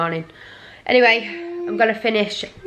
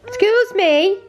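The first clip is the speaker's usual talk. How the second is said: mock offended; 14 semitones higher, 5 dB louder, slower than usual. Real, not acted.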